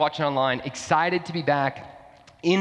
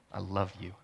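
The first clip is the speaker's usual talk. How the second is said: soft, low, tender declaration; tentative confession; 9 semitones lower, 11 dB quieter than usual. Retold or imitated. Boyfriend first confesses love to his girlfriend, softly and tenderly. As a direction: say it quietly, much lower. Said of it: "I can remember going and saying".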